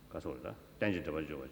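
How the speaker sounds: ordinary delivery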